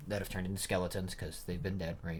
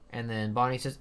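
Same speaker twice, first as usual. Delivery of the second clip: ordinary talk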